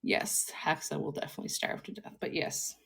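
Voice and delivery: soft unvoiced aside; whisper. Real, not acted. Host whispering to cohost, soft unvoiced. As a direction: whisper it under breath.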